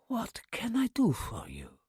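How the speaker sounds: put-on whispered, slow Russian-accented old lady; soft Russian accent. A narrator's reading. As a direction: breathe it like a breeze, Russian accent. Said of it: "the Russian accent undeniable".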